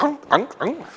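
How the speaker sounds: high squeaky chew toy honks; mock squeaky dog yelps; squeaky toy noise. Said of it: "It's like".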